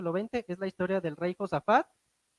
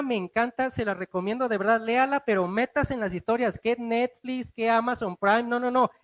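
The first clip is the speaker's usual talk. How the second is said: normal talking voice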